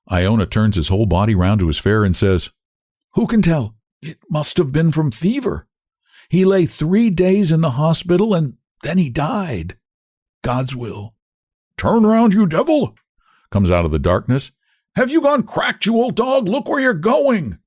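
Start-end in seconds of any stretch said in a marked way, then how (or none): 3.1-11.1 lightly voiced old cabman, grieving. Iona's grieving voice. 11.8-13.0 high furious cry of turn round you devil; angry shout. Acted. Voice shouting from darkness. 14.9-17.6 much higher scolding voice, slowing at the end; high scolding stranger; scolding passerby. Acted.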